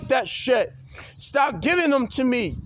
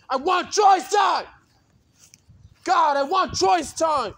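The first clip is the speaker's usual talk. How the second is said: higher, slow whining shout; whiny demand; 5 semitones higher than usual. Real, not acted. Demanding choice time, high whining shout. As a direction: whine it out hard.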